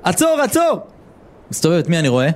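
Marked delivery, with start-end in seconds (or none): 0.0-0.8 shouting stop. Retold or imitated. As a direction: cry out to stop him. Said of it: "אני שומע צעקות".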